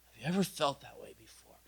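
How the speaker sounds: soft breathy whisper; hushed intimate question. Real, not acted. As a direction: lean in and whisper it.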